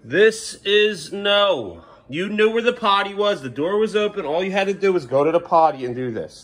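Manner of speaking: stern, exasperated parental reprimand. Real, not acted. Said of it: "I have to raise my voice".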